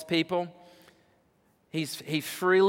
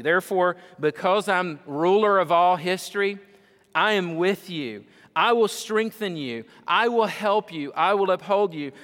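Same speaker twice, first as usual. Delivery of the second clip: rising preaching; 4 semitones higher, 5 dB louder than usual. Acted. Repeating promises, building.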